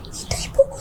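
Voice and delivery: whispered father. Retold or imitated. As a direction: breathe it as a whisper.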